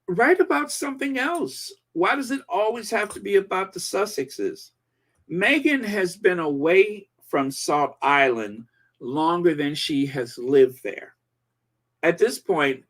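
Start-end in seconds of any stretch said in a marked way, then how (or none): none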